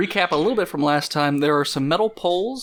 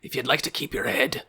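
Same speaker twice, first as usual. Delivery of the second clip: put-on menacing warrior voice; role-played threat with a rough edge; threatening axe man. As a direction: warn them off as a hostile gang leader.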